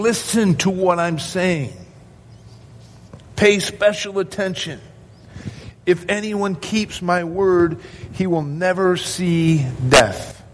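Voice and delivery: high, urgent call to "Listen", slowing on "never see death"; emphatic. Retold or imitated. Preacher voices Jesus urging them to pay special attention, emphatic. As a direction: press every phrase home, demanding attention. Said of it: "he does it in an emphatic way".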